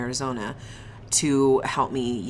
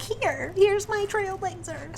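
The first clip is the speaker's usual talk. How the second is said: mom voice; slower than usual. Retold or imitated. Voicing mom giving car.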